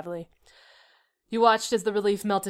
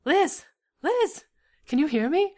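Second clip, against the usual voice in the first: high, raised urgent calls; dramatic worried calling; 8 semitones higher, 5 dB louder, slower than usual. A narrator's reading. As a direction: call a name to rouse someone. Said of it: "Calling into your ear".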